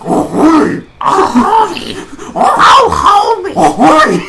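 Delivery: high, loud hammed-up moaning cries; Marge moaning. Marge crying out to Shrek in bed, hammed-up high wails. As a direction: wail it way up high, overdone passion. Marge voiced during sex.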